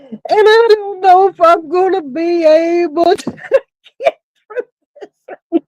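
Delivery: barely gets the words out through laughing; crying with laughter. Real, not acted. Director fearing she can't hold it together, laugh-crying. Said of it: "I'm crying".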